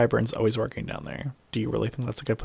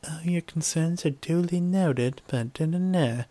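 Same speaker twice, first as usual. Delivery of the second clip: prissy mocking singsong, slow and higher; mock polite; 6 semitones higher than usual. S0 mocking Sansa brushing off the lords, prissy singsong. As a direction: mimic a dismissive lady waving it off.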